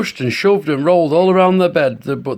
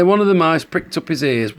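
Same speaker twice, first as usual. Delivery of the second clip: usual speaking voice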